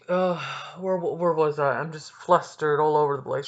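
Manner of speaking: usual speaking voice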